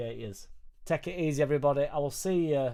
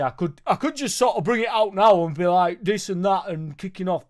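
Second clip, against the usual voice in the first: higher, louder lad-from-the-pub bluster; pub guy voice; 6 semitones higher, 12 dB louder than usual. Pretend. Streamer slipping into his pub guy voice for viewers. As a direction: ham up the mouthy pub bloke. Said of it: "pretend to be a guy from the pub".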